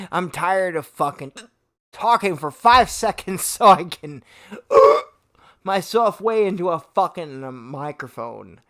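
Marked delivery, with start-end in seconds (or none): 4.5-5.0 a sudden high hiccup gasp catching the breath; jolted, involuntary gasp for air; sharp gasp. Real, not acted. Streamer cut off mid-rant by a sharp hiccup gasp.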